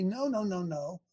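ordinary talk

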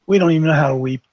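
usual speaking voice

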